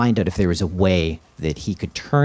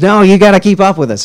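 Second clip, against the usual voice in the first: mock [name] yell; 13 semitones higher, 17 dB louder than usual. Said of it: "And he says".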